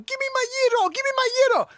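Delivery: very high mock yell. Gyro owner demanding his stolen food back, high mock yelling. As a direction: imitate an outraged man demanding, loud and squealing. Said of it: "He's yelling".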